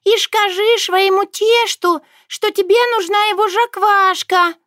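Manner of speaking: high, squeaky and solemn; ringing important little voice. A narrator's reading. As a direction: ring out with self-importance. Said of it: "голосок зазвенел от важности момента".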